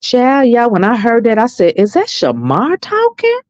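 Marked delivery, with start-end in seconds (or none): 1.8-3.4 high, drawn-out disbelief; can't believe it; incredulous. Retold or imitated. Retold disbelieving question.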